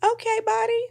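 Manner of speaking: high mock-dopey voice repeating himself; mocking groom. Retelling the groom's words to a friend, mock dopey high voice. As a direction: ham up the groom's lame reaction. Groom's flat reaction to bride.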